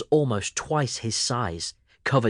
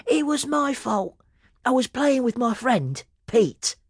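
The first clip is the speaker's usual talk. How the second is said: put-on very high, eager little boy's voice; eager kid. A narrator's reading. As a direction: own up brightly. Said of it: "Sammy shouted and ran over".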